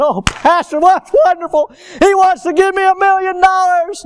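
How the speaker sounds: high, loud, hammed-up delight; mock gushing. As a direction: gush like an overjoyed church member. Mimics delighted man's imagined reply.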